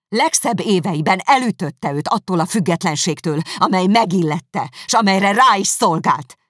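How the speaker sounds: loud, high, building anger; furious tirade. Acted. Elizabeth blaming Darcy for Wickham's ruin, furious and loud. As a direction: lash out at him.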